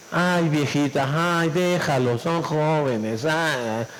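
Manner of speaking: slow, low, languid dad voice; easygoing passive father. Retold or imitated. Pushover father soothing his wife about the kids, languidly easygoing. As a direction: imitate a sedated dad sighing out every word. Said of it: "Si tu papá es pla-, pasiflorino".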